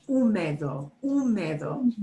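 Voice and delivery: "Húmedo" stressed on the first syllable, OO; punched accented syllable. Shown as a demonstration. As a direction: say "Húmedo" as OO-meh-do, H silent. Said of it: "people would not think to punch that syllable".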